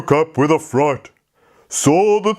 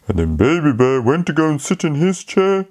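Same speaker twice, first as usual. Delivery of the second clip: ordinary delivery, nothing marked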